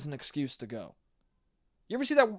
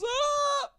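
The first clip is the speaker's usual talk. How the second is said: mock whine. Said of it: "the kid goes".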